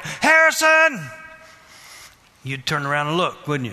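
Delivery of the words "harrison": high, loud, drawn-out yell; yelled name. Acted. Somebody yelling to a person across the room, yelling. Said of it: "somebody yelled".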